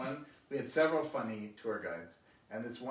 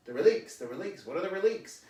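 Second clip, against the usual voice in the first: imitating baffled tourist; 7 semitones higher, 7 dB louder than usual. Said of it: "And he says".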